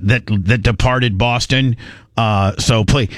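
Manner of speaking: low, even scramble-request voice; practice military radio call. Acted. Controller calling a military base in a drill, low and procedural. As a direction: report it like a routine air traffic call. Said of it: "when they practice this, it'd be like".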